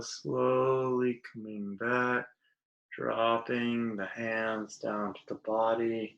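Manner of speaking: slow, drawn-out soothing instruction; guided meditation. Acted. Teacher guiding hands as if through syrup, guided meditation. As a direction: stretch the words to match slow movement.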